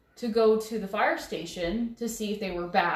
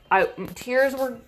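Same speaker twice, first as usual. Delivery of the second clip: ordinary talk, nothing marked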